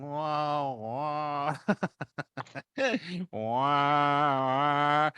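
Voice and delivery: mock trippy wah-wah noise; wobbly wah-wah vision sound. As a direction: mimic the swimming of her eyes with a warbling "wah, wah". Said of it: "my eyes start, like, going".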